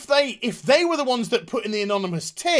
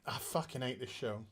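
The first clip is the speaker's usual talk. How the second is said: low and much softer, spent after yelling; defeated and drained; 12 semitones lower, 12 dB quieter than usual. Real, not acted. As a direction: sink back, utterly worn out by the show. Deflated after the outburst.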